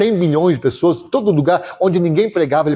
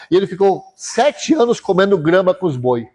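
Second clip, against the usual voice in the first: ordinary delivery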